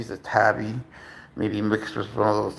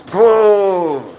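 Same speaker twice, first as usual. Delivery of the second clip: excited exclamation; 8 semitones higher, 8 dB louder than usual. Real, not acted. Celebrating the cat's move.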